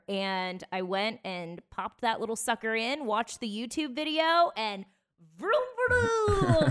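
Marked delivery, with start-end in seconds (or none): 5.4-6.7 high, loud playful engine vroom; engine noise. Retold or imitated. Speaker mimics her car starting up, high playful vroom. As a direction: sound out the car roaring back to life.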